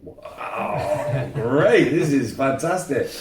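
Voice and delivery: loud, high "Oh, wow, great" with laughter; delighted praise. Retold or imitated. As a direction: burst out with joyful approval. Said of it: "He said".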